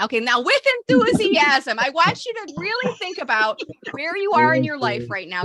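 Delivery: high, loud pep-talk push; rally a half-hearted group; rousing enthusiasm. Real, not acted. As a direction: coach firing up the room. Rallying group after weak response.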